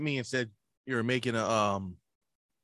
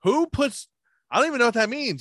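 high, louder, broken-off disbelief; baffled protest; 11 semitones higher, 5 dB louder than usual. Retold or imitated. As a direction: act out being utterly confused. Retelling his baffled objection.

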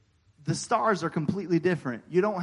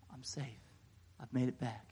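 much softer, breath of relief; quiet relief; 5 semitones lower, 13 dB quieter than usual. Retold or imitated. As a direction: let the relief settle in quietly. Relief on hearing welcome home.

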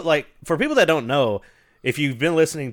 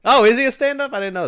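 higher, louder surprised question; sudden surprise at news; 11 semitones higher, 5 dB louder, faster than usual. Real, not acted.

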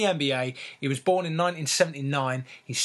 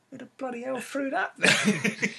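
incredulous; 10 semitones higher than usual. Real, not acted. Incredulous outburst, far higher.

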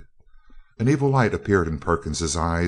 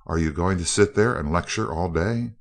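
usual speaking voice

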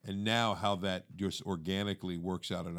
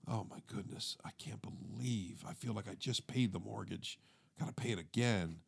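groggy tired self-talk; 8 dB quieter than usual. Retold or imitated. Tired man muttering to himself in the shower, worn out. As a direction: mumble to yourself, worn out and overwhelmed. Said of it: "you're just kinda tired and overwhelmed".

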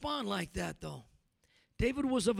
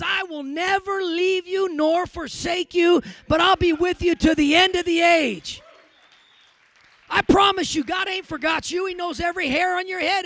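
preaching at full force; 5 semitones higher, 12 dB louder than usual. Acted. Preacher promising his congregation God never forgets, full-force preaching.

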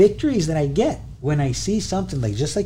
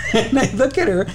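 laughing; 7 semitones higher than usual. Real, not acted. Laughing with pride about daughter.

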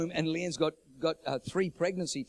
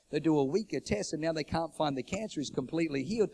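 usual speaking voice

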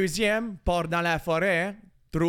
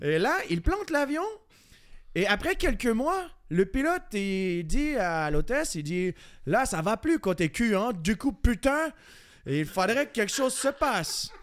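lilting mock French-from-France accent; accent français de France; 3 semitones higher than usual. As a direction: tell the joke as a hammed-up Frenchman, in accent. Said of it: "j'ai chié l'autre avec l'accent français". (faux accent de France chantant)

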